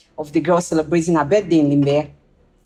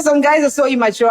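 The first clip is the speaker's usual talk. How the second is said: laughing quote; 8 semitones higher than usual. Retold or imitated. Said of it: "She said".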